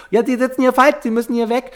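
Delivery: high, officious usher; lightly voiced usher with the torch; mock usher voice. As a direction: play the usher pushing people out. Voicing the usher.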